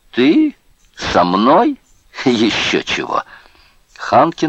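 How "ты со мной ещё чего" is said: high, loud incredulous questions; derisive, sharply rising, then a dismissive laugh; scornful disbelief. A narrator's reading. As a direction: laugh off the request with contempt. Boss scornfully refuses to talk.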